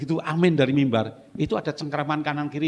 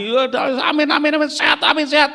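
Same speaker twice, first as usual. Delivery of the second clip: mock eager amens; 10 semitones higher, 4 dB louder than usual. Mocking congregation eager amens.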